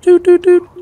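normal talking voice

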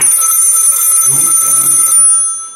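usual speaking voice

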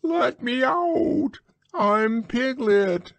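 very high squealing cries; crying piglet. A narrator's reading. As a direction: squeal to be let out.

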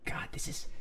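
unvoiced embarrassed whisper; flustered whisper. Real, not acted. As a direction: breathe it out mortified.

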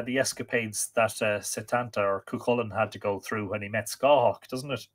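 ordinary delivery, nothing marked

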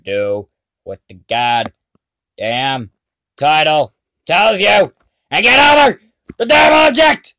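angry shout, building up; berserk rage. Real, not acted.

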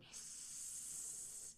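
long drawn-out hiss; snake hiss. A narrator's reading. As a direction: stretch the s like a snake. Imitating a snake.

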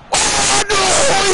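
high, loud wail of frustration; dismayed cry. Real, not acted. Gamer sees his winger's run fail, wailing. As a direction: cry out at the botched play. Missed attack in game.

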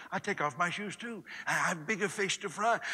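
ordinary talk, nothing marked

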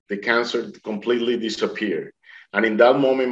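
normal talking voice

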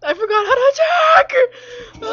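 high, loud panicked cry; panicked shout. Real, not acted. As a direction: yelp it out in real fright. Panicking as a zombie attacks.

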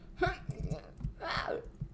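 breathy nervous giggle; a soft, uneasy laugh. Real, not acted. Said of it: "The nervous laughter".

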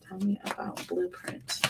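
softly whispered plea to spirit; hushed whispered invocation. Acted. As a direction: breathe the request as a secret prayer.

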